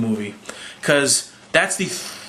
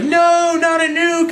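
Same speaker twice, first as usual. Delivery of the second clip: much higher and louder, panicked no; alarmed shout; 3 dB louder, slower than usual. Retold or imitated. Nick Fury objecting to the council's nuke, in a high alarmed shout. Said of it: "And Nick Fury's like".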